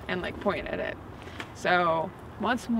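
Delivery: ordinary talk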